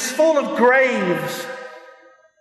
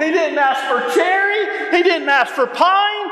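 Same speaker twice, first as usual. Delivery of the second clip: high, rising list to a ringing finish; building preacher's climax; 6 semitones higher than usual. Real, not acted. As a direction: build each phrase higher toward the triumphant payoff. Preacher building to his punchline.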